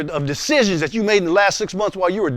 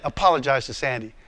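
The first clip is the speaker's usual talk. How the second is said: low, unmoved command; acted voice of God, firm and low; God's voice; 4 semitones lower, 3 dB quieter than usual. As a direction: repeat the order calmly, pitched down. God's unmoved reply in acted argument.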